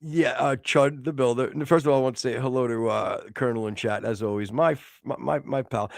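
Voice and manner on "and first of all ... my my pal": usual speaking voice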